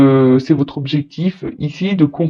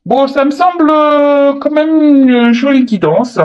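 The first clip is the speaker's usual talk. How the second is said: pleased; 9 semitones higher, 4 dB louder, slower than usual. Real, not acted. Pleased by the card spread.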